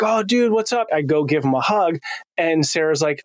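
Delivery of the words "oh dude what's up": higher-pitched, upbeat hello to a buddy; retold excited greeting. Dad greets son walking into house, excited. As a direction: greet him like a happy surprise.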